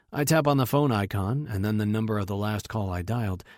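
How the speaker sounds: plain reading voice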